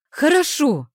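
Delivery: high, loud cry of giving in; exclaiming. A narrator's reading. Arina pulling free of her pushy friend, exclaiming. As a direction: cry out to get her off you. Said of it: "воскликнула я".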